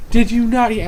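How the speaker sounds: mock accusatory outburst; retold yelling. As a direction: imitate an outraged mother yelling. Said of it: "she's yelling at me".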